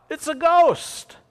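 high, loud cry of terror, slow; frightened cry. Acted. Disciples seeing Jesus on the water, frightened high cry. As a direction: shriek it out like a scared sailor. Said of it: "they cried out".

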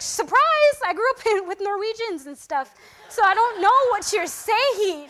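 hammed-up, exasperated announcement; retold mock surprise. Telling the teammate she can't understand him, mock-surprised outburst.

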